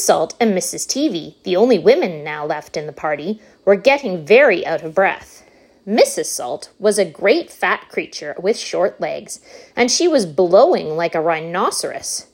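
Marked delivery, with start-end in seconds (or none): none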